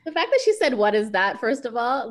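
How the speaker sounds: incredulous outburst at the clerk's question. Real, not acted. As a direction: react with loud disbelief. Outraged reaction to the story.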